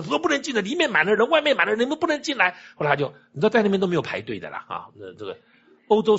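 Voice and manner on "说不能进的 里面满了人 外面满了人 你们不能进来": high-pitched doorkeeper turning people away; 守门人拦人. Retold or imitated. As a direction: insist in a raised pitch, imitating the doorkeeper. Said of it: "就那守门说". (模仿守门人提高音调拦人)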